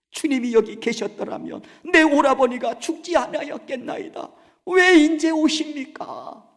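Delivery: loud, high crying plea at his feet; wailing lament. Acted. Mary weeping at Jesus' feet, loud wail. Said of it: "그러면서 그 발 앞에 엎드려 막 우는".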